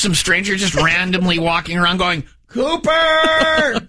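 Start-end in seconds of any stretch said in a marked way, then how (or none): 2.5-3.9 high mock call across the gym. Stranger wandering the gym calls out for the kid. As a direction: call the kid's name out, pitched way up. Stranger calling kid's name.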